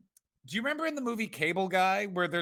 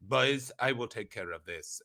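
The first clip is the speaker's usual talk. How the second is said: Elon Musk; 6 semitones lower, 3 dB quieter than usual. Acted. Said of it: "and he's like".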